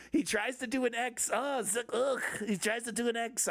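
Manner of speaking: high, badly faked German accent. Comic as a dictator mocking Trump, poor German accent. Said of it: "I can't do a German accent".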